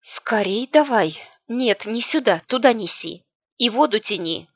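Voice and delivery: loud, high-pitched commanding; urgent command. A narrator's reading. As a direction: call out hurried orders across a yard. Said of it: "всплеснула руками и велела".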